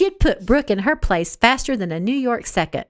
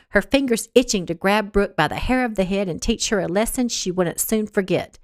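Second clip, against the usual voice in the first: ordinary delivery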